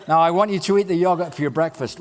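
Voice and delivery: a hint of a mother at breakfast; mum's voice. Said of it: "a mum of a five-year-old kid going".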